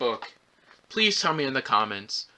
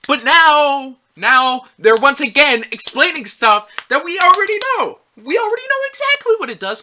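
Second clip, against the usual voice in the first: loud, high-pitched outburst; exasperated rant; 11 semitones higher, 8 dB louder than usual. Real, not acted. Reviewer fed up with stuff explained once again, exasperated shouting. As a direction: rant at full voice, pitch climbing.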